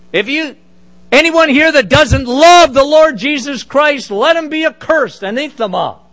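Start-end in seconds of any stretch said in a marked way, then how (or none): none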